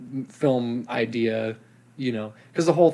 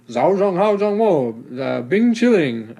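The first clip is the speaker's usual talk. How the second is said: John Cena Mandarin; 4 semitones higher, slower than usual. Retold or imitated. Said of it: "And he goes".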